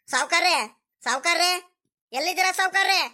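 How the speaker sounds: hollering the master's name across the farm; calling out. Acted. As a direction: call out for the boss from a distance. Searching farm, calling for landlord.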